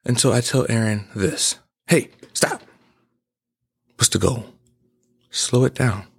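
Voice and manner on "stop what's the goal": ordinary delivery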